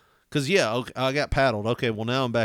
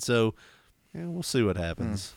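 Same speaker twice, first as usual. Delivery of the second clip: ordinary delivery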